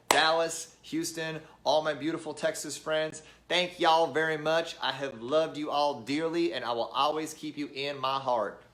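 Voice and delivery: put-on Southern accent from Texas; terrible Texas accent. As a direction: badly fake a Texan farewell. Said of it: "That was my terrible Texas accent".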